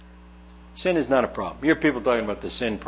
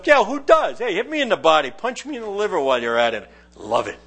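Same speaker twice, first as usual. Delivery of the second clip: sarcastic fake enthusiasm about body punches; sarcastic mock delight; faster than usual. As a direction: pretend to love the punches, dripping irony. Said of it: "I think to myself".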